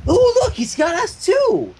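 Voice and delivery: high, slow excited squeal; squealing Pinkie. Acted. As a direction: squeal with delight over the dolls. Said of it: "squealed Pinkie".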